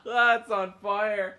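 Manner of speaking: high, loud laughing line; laughing while talking. Real, not acted. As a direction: laugh through the words. Laughing at smoking ship.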